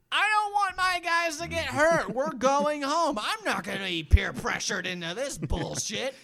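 high, loud imitated coach outburst; mock indignant coach. Comic imagines Rudy's coach refusing the crowd, mock indignant yelling. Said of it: "and the coach is like".

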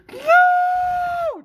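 a huge, high, desperate "No!"; anguished scream. Retold or imitated. Fan watching his hero knocked out, screaming in anguish. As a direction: scream it at the top of your lungs. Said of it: "Just screamed at the top of my lungs".

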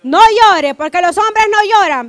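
loud, firm order to a son; stern parent to boy. Retold or imitated. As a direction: lay down the rule to a little boy. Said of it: "¿qué le decimos?". (regaño firme y fuerte a un varón)

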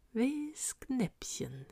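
very slow, soft whisper; hushed, lingering murmur of breath. A narrator's reading. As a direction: whisper it drawn out, to yourself. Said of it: "flüsterte sie".

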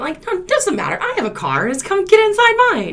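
high-pitched, breezy reassurance; exaggeratedly casual, lilting high; mock agreeable. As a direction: play up an easygoing, accommodating self. Voicing her over-eager past self.

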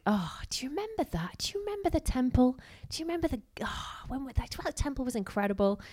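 high, wistful reminiscing elder; nostalgic elders. Retold or imitated. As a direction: sigh back to the old days, voice up high. Older exiles reminiscing about temple.

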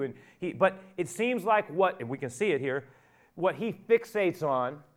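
loud, driving sermon delivery; preaching at full force. Acted. Preacher retelling Peter's question to the congregation, full force. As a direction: preach it out to the whole room.

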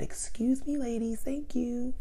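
good formal voice. Retold or imitated. To the women in the store, high polite formal voice. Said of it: "in the good voice, and the nice voice, and the formal voice".

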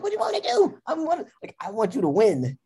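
high-pitched, giddy plea; excited pleading. Retold or imitated. Girlfriend backstage desperate for him to win, giddy pleading. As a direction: plead it high, on the edge of laughter. Recalls offering help, laughing.

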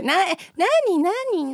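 a light imitation of a coaxing, cooing voice; fake cute pouty voice. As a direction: mimic a woman cooing what, what. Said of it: "猫なで声".

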